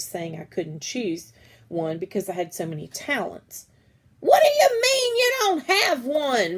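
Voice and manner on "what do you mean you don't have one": high, loud shocked yell; teacher yelling. Acted. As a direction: shout it in disbelief. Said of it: "Margaret's teacher yelled".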